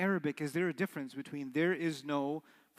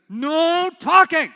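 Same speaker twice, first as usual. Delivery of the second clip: high, loud, slow "No talking!"; authoritative shout; 10 semitones higher, 17 dB louder, much slower than usual. Shown as a demonstration. Teacher finally laying down the law with a shout. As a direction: bark "No talking!" with full authority. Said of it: "I had so much authority when I said".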